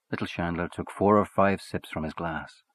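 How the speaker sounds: plain reading voice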